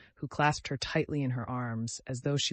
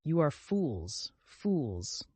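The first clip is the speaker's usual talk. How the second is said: bitter cry; 3 dB quieter, much slower than usual. Acted. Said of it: "she cried".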